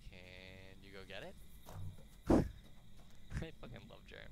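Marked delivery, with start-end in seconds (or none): 1.4-3.4 loud breathy laugh; laughter. Real, not acted. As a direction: crack up delighted. Laughing at Germ.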